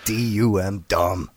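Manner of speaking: low, soft deadpan; mock dim-witted spelling.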